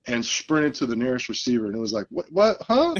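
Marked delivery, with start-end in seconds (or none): 2.1-3.0 confused huh. Retold or imitated. Defenders confused, acted out.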